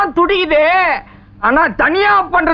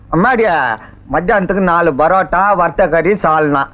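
very low, monotone drowsy voice; sleepy; 12 semitones lower than usual. Acted. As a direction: sink down low as if nodding off. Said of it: "எனக்கு ஒரே தூக்கமா வருது".

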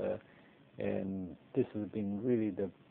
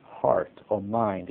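"heart or mind" said slowly and louder, each word weighted; emphasizing the words; 3 semitones lower, 5 dB louder, much slower than usual. Shown as a demonstration. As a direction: lean on "heart or mind", slow and deliberate. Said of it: "emphasize the word".

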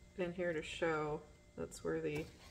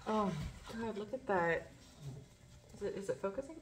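normal talking voice

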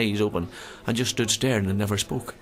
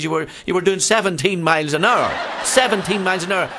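higher, louder mock indignation; acted-out argument, voice raised; exasperated insistence; 8 semitones higher, 5 dB louder than usual. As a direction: hammer it home like an incredulous policeman in a joke. Guard argues back in joke.

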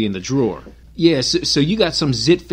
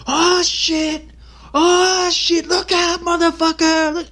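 high, excited mock yell at the movie; moviegoer yelling at screen; 14 semitones higher, slower than usual. Audience member yelling at the hero on screen, high mock yell. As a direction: play a rowdy audience member warning the hero. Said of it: "they'll yell".

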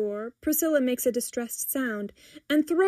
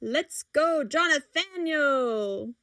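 higher, stretched-out hype call; chorus cheer; 5 semitones higher, much slower than usual. A narrator's reading. Customer hyping up the cookie man, cheering. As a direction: draw out the name as a cheer. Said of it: "A small chorus rings out".

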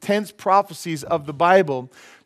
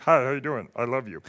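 stiff formal; 4 semitones lower, 4 dB quieter than usual. Retold or imitated. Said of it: "He wasn't like".

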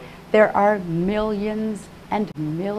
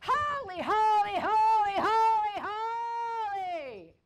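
high, tearful cry of adoration; sobbing high-pitched chant; wailing worship; 13 semitones higher, 8 dB quieter, much slower than usual. Retold or imitated. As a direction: wail each word, stretching the last. Reliving sobbing cry to God.

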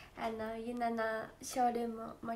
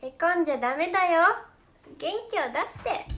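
cute encouraging voice; 4 semitones higher, 8 dB louder than usual. Acted. Cheering fans with cute line.

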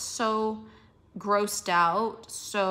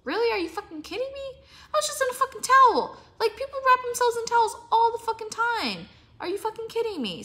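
much higher, loud and fast outburst; incredulous fury, pitched way up; outraged rant; 13 semitones higher, 5 dB louder, faster than usual. Real, not acted. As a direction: let the disbelief explode into a high angry rant. Outraged at towel-selfie criticism.

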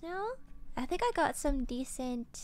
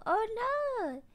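surprised; 9 semitones higher, 6 dB louder than usual. Real, not acted. Sympathy for tired friend.

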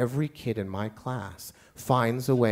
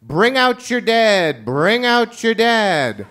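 slow, high, loud shout; frustrated teacher shouting at kids; 9 semitones higher, 8 dB louder, much slower than usual. Retold or imitated. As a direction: bellow it across the classroom, drawn out. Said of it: "I finally shouted out".

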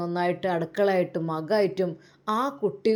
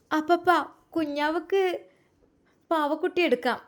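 put-on higher, sweet little girl asking grandpa; 6 semitones higher than usual. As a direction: play a small child pleading for the baby's doll. Granddaughter asks grandpa for doll.

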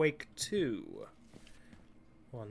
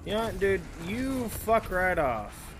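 annoyed telling off; 4 semitones higher, 3 dB louder than usual. Real, not acted. Telling off a game enemy.

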